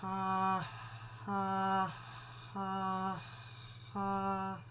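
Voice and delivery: soft breathy ha, on one note; soft, breathy vocal exercise ha. Shown as a demonstration. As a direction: breathe each ha out softly. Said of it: "Get used to how this feels".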